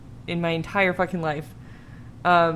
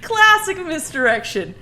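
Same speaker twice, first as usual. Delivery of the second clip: laughing; 11 semitones higher, 6 dB louder, much slower than usual. Real, not acted. Laughing at a ghost's joke.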